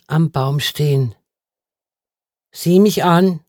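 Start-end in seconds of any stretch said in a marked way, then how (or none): 2.5-3.4 firm order, louder and higher; insistent command. A narrator's reading. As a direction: command someone to look at you. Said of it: "befahl er wieder".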